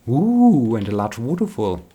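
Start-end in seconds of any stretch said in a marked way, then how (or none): 0.1-0.7 high, loud "ooh" of wonder; delighted "ooh". Real, not acted. Gamer spotting a waterfall while exploring, delighted ooh. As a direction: let out a big, rising-then-falling ooh.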